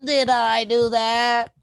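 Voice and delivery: slow, high, nasal-free Urkel whine; Urkel impression. Comic doing Urkel's catchphrase for the crowd, slow high voice. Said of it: "Urkel's family".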